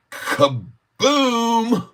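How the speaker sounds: a sudden, very high explosion shout; cheering a price spike; kaboom. Real, not acted. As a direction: burst out like a firework. Stock chart jumps up.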